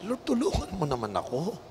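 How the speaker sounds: soft, low plea to God; weary, half-voiced prayer for help; subdued plea. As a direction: pray it softly, pleading. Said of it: "willing to cry out to God".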